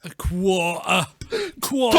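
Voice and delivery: exaggerated put-on Tomar accent. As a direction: stretch the word in a silly accent. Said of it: "This is the Tomar accent".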